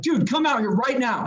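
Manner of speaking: insistent, imitated yell; getting at him. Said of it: "kinda getting at".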